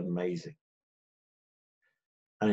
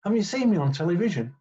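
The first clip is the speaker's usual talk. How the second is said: higher, affronted do-you-know-who-I-am voice; Emlyn Hughes indignant; 8 semitones higher than usual. Retold or imitated. England captain protesting to the young referee, higher and indignant. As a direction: puff up with wounded pride, pitched up. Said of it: "He goes".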